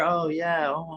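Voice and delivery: high, sighing mock mother; mock sappy mom. Parent filming her kid, sighing mock coo. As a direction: coo it, fading away. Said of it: "they're like".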